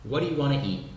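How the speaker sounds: "What do you wanna eat" run together, T of "eat" unreleased; quick everyday "What do you wanna eat"; linked casual speech. Shown as a demonstration. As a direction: blend "What do you" into "waddayu". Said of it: "Nós vamos falar".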